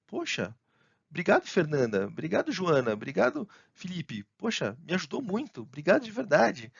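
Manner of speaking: heartfelt thanks with a lifted voice; grateful intonation. Acted. Thanking helpful colleagues by name, warm and grateful. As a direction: let real gratitude colour each name. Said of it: "a entonação da voz".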